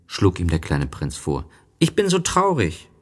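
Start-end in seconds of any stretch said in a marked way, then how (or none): 1.8-2.9 put-on high childlike story voice; voiced little prince, lifted well up. A narrator's reading. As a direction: pitch it high for a small boy.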